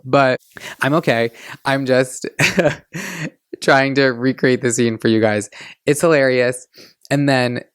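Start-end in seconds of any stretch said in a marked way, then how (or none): 2.3-3.3 high burst of laughter. Real, not acted. Laughs at own demon voice.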